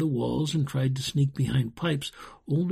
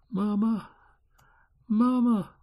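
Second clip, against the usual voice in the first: put-on small high child's plea, weakly weeping; 9 semitones higher than usual. A narrator's reading. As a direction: whimper it high like a little child. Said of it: "wept a little child very quietly".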